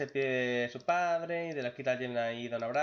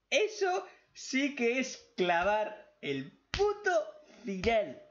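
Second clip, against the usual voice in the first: slow, much higher and louder; emocionado; 10 semitones higher, 4 dB louder, slower than usual. Real, not acted. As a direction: savor each word, thrilled. Raving about the finale. (entusiasmo lento y agudo)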